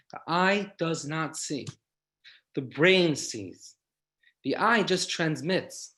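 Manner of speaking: very slow, each short sentence set apart; slow deliberate. Acted. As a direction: spell it out slowly for the congregation. Quoting the doctors' key point.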